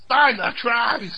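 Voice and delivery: slow, high, stretched Ocker shout; Ocker Aussie shout. Retold or imitated. Friends imitating Alf, a slow stretched Aussie shout. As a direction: drag out the cry like Alf. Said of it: "That's what he talks like".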